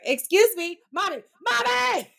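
put-on impatient child. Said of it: "he's like".

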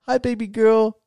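put-on high, bright bye-bye to a baby; dad singing bye-bye to his baby girl; sing-song baby talk. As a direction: coo it to your daughter like a dad. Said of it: "and, like".

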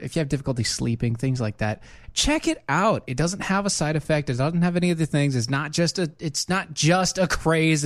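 podcast sponsor read. Acted.

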